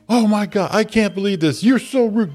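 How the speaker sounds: imitating a store owner losing it; mock outraged shop owner. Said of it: "Lost her shit".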